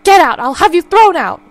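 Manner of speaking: put-on angry old woman yelling at an intruder; furious shout. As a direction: throw the stranger out. Mother orders intruder out.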